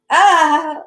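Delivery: high, loud, brief "ah"; surprised cry. A narrator's reading. Prisoner struck by a sword, surprised cry. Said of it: "cried out briefly and surprisedly".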